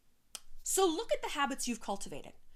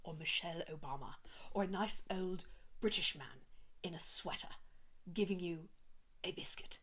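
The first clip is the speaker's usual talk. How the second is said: whispered, soft and hushed; 5 semitones lower, 8 dB quieter than usual. Shown as a demonstration. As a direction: hush it like a gentle secret. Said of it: "sounds like Oprah".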